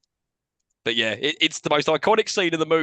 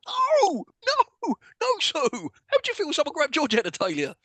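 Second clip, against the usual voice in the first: squeaky high pleading protest; mock outrage; 11 semitones higher than usual. Scolding the character Sue, high squeaky outrage. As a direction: shriek at Sue in mock disbelief.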